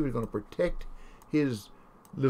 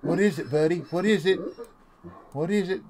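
higher, louder coaxing to the dog, what is it buddy; 7 semitones higher, 4 dB louder than usual. Real, not acted. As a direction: call to the dog like he is a little buddy. Asking the barking dog.

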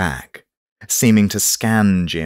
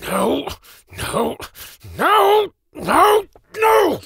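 frantic; much slower than usual. Acted. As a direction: panic building with each no. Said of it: "growing more frantic by the moment".

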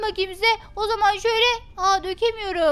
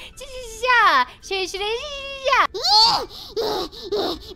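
crackle noise; much slower than usual. Acted. Imitates fire crackling.

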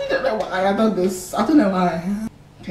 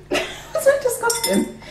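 laughing; slower than usual. Real, not acted. Laughing at the taste.

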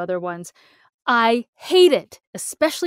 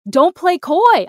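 louder, higher, scolding coy writers; fed-up outburst; 9 semitones higher, 8 dB louder, much slower than usual. Real, not acted. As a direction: snap at the author to stop playing coy. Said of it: "I hate it".

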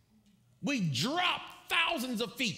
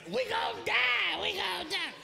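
screaming; 10 semitones higher than usual. Retold or imitated. Head intercessor panicking on the plane, screaming. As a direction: wail it like a panicked passenger, pitched way up. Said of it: "she's screaming".

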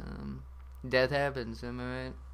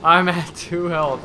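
loud, raised wail; loud and higher, dragging out the alarm; alarmed cry; 4 semitones higher, 9 dB louder than usual. Real, not acted. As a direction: cry out in dismay at nearly dying. Nearly dead in game.

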